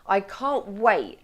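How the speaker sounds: "can't wait" slow and weighty, each word held; stress on CAN'T WAIT. Shown as a demonstration. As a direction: lean hard on "can't", then land "wait". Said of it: "the words that I would say that I emphasised".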